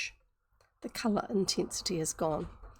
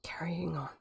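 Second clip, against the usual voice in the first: whispered aside; 3 semitones lower, 7 dB quieter than usual. Real, not acted. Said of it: "aside whispered to listeners".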